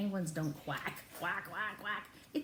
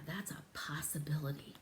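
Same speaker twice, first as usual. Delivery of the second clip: usual speaking voice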